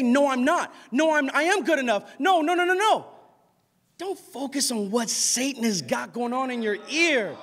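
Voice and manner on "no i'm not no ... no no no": loud, high-pitched defensive rebuttal; mounting mock defensiveness; acted protest. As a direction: argue back insistently, getting higher. Acts out arguing back at accusations.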